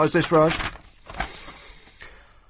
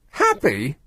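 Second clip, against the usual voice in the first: ordinary delivery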